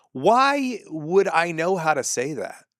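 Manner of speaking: high, slow incredulous whine; exasperated disbelief. Real, not acted. Exasperated retort, far voice.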